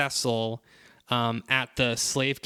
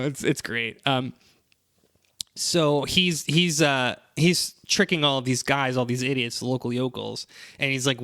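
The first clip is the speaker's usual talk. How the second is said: ordinary delivery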